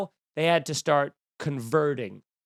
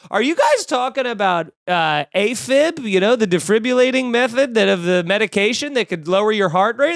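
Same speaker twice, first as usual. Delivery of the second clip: mock ad actor; 5 semitones higher, 5 dB louder than usual. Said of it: "and they go".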